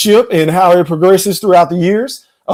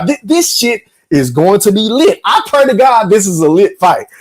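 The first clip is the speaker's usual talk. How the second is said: usual speaking voice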